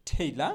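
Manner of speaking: ঠেইলা with aspirated ঠ and open "laa", no r; corrected pronunciation. Shown as a demonstration. Teacher modelling tailor for learners, ঠেইলা with aspirated start. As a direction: start ঠেইলা on a puffed "th", drop the r. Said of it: "এই তিনটা আমরা কী দেখলাম".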